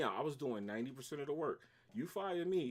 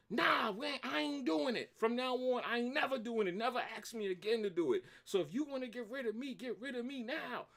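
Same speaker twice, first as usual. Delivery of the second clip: high-pitched indignant tirade; going crazy; 10 semitones higher than usual. Retold or imitated. Worker refuses to clean up mess, going crazy on boss. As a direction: rant in a much higher pitch, outraged. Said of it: "I went crazy. I was like".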